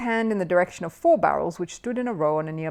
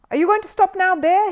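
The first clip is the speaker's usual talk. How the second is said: high, loud, hopeful plea; hoping he'll quit, pitched up; 13 semitones higher, 8 dB louder than usual. A narrator's reading. Host hopes Paddington will stop, pitched high. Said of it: "he asked hopefully".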